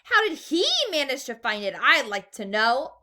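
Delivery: shouted angrily. A narrator's reading. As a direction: bark it out, jealous and furious. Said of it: "a large voice shouted angrily".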